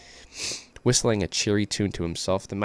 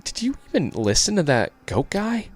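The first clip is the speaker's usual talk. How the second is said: lightly raised worried voice; higher cautious character voice; 6 semitones higher than usual. Acted. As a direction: warn them, a touch higher.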